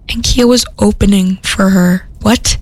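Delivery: usual speaking voice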